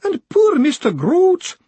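high, slow, loud sob; slow high sobbing lament; sobbed. Acted. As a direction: sob the name out. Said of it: "the woman sobbed".

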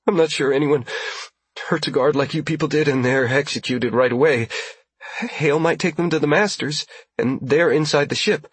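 tense high voice, hurried; frightened woman voice. Acted.

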